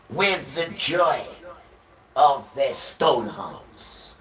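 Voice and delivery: slow, loud, high-pitched proclamation of scripture; solemn scripture reading. Acted. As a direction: thunder each phrase out slowly like a prophet. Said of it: "surely it says".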